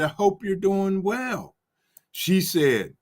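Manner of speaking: normal talking voice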